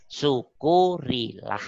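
Syukurilah drawn out slowly, each syllable weighed; slow emphatic repeat. Shown as a demonstration. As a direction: stretch Syukurilah out slow and solemn. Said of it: "Syukurilah (ucapan pertama)". (Syukurilah diucapkan pelan dan panjang, penuh penekanan)

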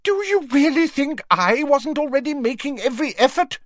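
very high-pitched indignant demand; strained, outraged protest; heated. A narrator's reading. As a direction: demand it hotly, voice way up. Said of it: "demanded Fudge heatedly".

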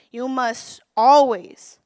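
You must always, slow and loud with always hit hard; stress on always. Real, not acted. Preacher stressing the scripture.

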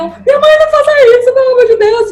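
loud, high, rushed plea; pleading. Retold or imitated. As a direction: beg mom to stop, mock-desperate and loud. Acted plea to mother during cutting. (súplica alta e desesperada, imitada)

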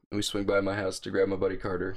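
ordinary talk

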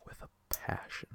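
hushed dramatic whisper. Pretend. Mock hatred repeated for effect.

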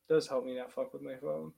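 normal talking voice

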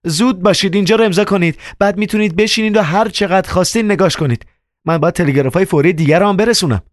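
a hurried telegram woman, fed up; annoyed woman. A narrator's reading. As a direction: hurry them along, irritated. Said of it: "زن با دلخوری گفت".

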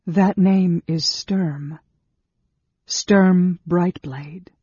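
each name drawn out, slow and solemn; slow reverent naming. A narrator's reading. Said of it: "said Sarah softly".

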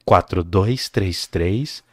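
low, slow ad read; slow late night radio DJ voice; radio announcer voice. Shown as a demonstration.

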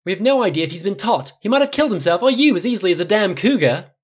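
high, loud angry tirade; furious roar. A narrator's reading. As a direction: roar the reprimand. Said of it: "Flynn's roar".

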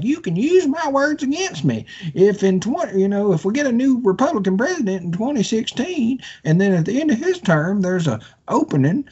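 high, sing-song mockery of a senator; mocking Lindsey Graham. Ranting podcaster mocks senator in a high whiny voice. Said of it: "And Lindsey Graham's like".

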